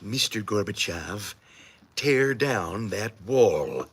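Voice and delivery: low, soft, very slow presidential delivery; low, very slow mock Reagan speech; Reagan impression. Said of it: "That's Reagan".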